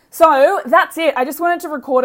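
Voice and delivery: bright announcer burst; excited. Real, not acted. Loud upbeat wrap-up.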